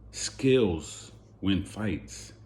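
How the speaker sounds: very slow, each word weighted; slow emphatic verdict. Real, not acted. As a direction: lay down the final word, slowly. Closing verdict.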